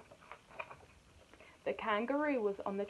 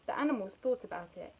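ordinary delivery, nothing marked

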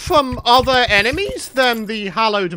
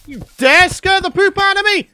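higher, louder hammed-up indignation; mock outrage; 8 semitones higher, 5 dB louder than usual. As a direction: tell off the trap like a villain. Scolding a trap in mock outrage.

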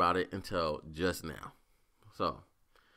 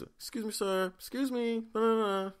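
put-on much higher, pleading old lady voice; imitating the homeless lady; 13 semitones higher than usual. Homeless lady calling at his car window, mock high plea. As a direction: squeak out a begging old woman. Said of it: "She just".

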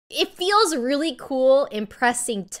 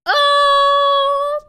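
high, loud startled cry of disbelief; surprised shout; 8 dB louder, much slower than usual. Real, not acted. Reacting to a friend's clip, a loud high surprised shout. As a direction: blurt it out, shocked and much higher.

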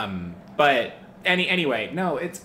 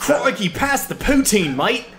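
high, loud mock Aussie; hammed-up Australian pretending to be Canadian; mocking Australian accent; 12 semitones higher, 6 dB louder than usual. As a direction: tease with a put-on Australian twang. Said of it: "That was my Canadian accent. Don't mock it".

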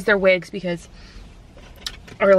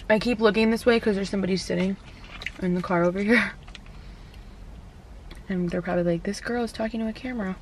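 eating between words; talking while chewing; 8 dB quieter, faster than usual. Real, not acted. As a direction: mouth partly full. Seen 2: holds bitten sandwich; cheeks suggest chewing while talking.

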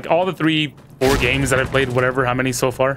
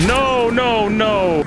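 dismayed cry; 8 semitones higher, much slower than usual. Real, not acted. Reacting to losing fight.

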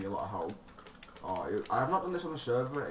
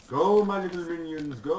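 high loud evil overlord; villain commanding minions; 8 semitones higher, 11 dB louder than usual. Pretend. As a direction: shout orders to your minions.

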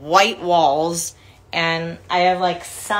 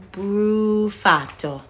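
drawn-out Italian pronunciation of Brufato; Italian accent; much slower than usual. Real, not acted. Reading out a fan's surname from a card, accidentally Italian. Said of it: "why I just made you Italian".

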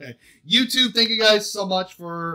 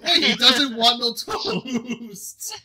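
slow words broken by laughter; laughing through words; 4 semitones higher, much slower than usual. Real, not acted. Repeating the botched lyric to friends, laughing. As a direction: quote it while laughing.

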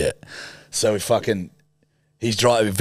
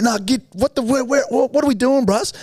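panicked questions. Retold or imitated. Panicking in the tuk-tuk, retold.

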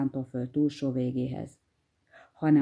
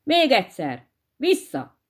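higher, louder angry yell; shout; 7 semitones higher, 3 dB louder than usual. Acted. Baffled hare demands a rematch, shouting. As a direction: bark the rematch order. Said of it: "nagyot rikkantott".

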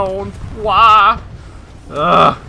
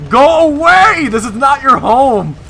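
loud, slow, higher scolding yell; shouting at enemies; 6 semitones higher, 6 dB louder, slower than usual. Real, not acted. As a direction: drive them off with a slow shout.

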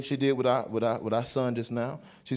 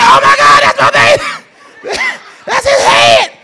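very high, loud, drawn-out shriek; panicked scream; 18 dB louder, slower than usual. Retold or imitated. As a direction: scream in hammed-up shock at the birth. Father sees baby's head arriving.

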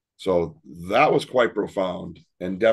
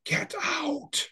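imitating a spirit voice high and soft; a high, hushed eerie whisper of a ghost on tape; ghostly recording voice; 7 dB quieter than usual. Teller mimics the spirit voice heard on the recorder, high and eerie.

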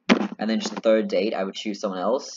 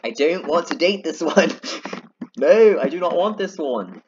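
high, loud, laughing refusal; mock protest; 7 semitones higher, 5 dB louder than usual. Player refusing the wrong date, hammed-up high protest. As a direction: whine it louder and higher, then slow for emphasis.

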